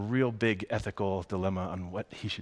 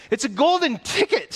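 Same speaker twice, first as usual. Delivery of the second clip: much higher, louder excited scream; 10 dB louder than usual. Acted. Shopkeeper spots the golden ticket, screaming with excitement. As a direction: shriek it out in amazement. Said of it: "screamed the shopkeeper".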